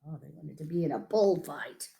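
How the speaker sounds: ordinary delivery, nothing marked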